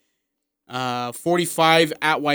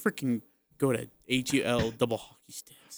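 mock grumble; 4 semitones lower, 13 dB quieter than usual. Real, not acted. Mock curse at teasing co-host.

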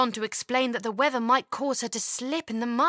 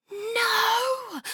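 dismayed cry; 11 semitones higher than usual. A narrator's reading. Grey sky instead of sun.

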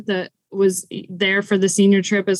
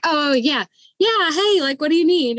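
higher, mock-casual cover-up; feigned casual recognition; 6 semitones higher than usual. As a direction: act like you knew it was your name all along. Caught not answering to fake name.

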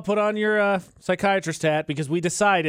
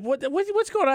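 high-pitched retold question; high, stammered mock confusion; baffled questioning; 11 semitones higher than usual. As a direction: mimic a puzzled colleague, pitched up. Retelling colleague's baffled question.

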